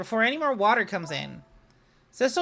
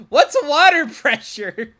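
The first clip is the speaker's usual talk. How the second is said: mock baffled; 4 semitones higher, 9 dB louder, slower than usual. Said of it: "we just don't know what some things mean".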